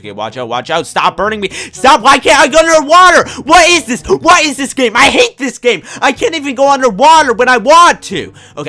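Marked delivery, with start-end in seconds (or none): 2.0-8.3 higher, louder ranting; angry complaint. Real, not acted. Gamer ranting that he can't go underwater. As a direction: rant at the game. Game won't let him dive again.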